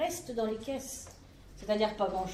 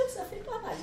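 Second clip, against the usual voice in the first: vieille dame; 8 semitones higher, 8 dB quieter than usual. Acted.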